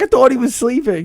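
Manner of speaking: high-pitched mock excuse; squeaky retold protest; imitated defensive friend. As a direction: plead innocence in a high voice. Voices friend's excuse to dad.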